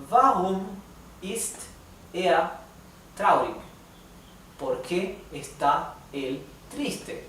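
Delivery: slow, careful read-aloud of each sentence; slow teacher's dictation pace. Shown as a demonstration. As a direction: read every word slowly for the class to follow. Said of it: "vamos a leer una vez más".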